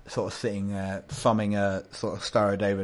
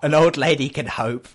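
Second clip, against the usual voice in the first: loud, higher-pitched mock-indignant old lady; humorous old lady; 5 semitones higher, 11 dB louder than usual. Old lady answering doubts she'll survive the ride, loudly humorous. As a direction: belt out a playful retort. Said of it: "she's getting a bit of humour now".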